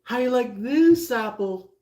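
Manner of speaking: hammed-up cocky sneer; mock taunting jab. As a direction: taunt them on the way out. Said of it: "you're just like".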